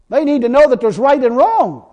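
ordinary talk

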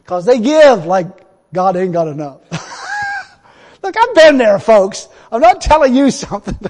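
loud, fervent preaching. Acted. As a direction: preach it to the crowd. Preaching at full force.

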